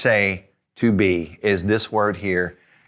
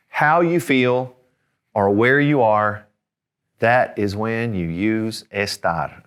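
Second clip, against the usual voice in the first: slow, spaced out for emphasis; measured delivery, one piece at a time; deliberate teaching phrase; slower than usual. Shown as a demonstration. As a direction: lay out the rule slowly, pausing between parts. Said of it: "and that is this".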